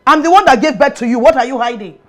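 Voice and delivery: heated maternal outburst; angry demand. Acted. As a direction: confront your son loudly and quickly. Mother demands truth from son.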